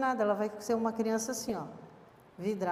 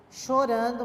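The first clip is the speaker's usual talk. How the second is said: usual speaking voice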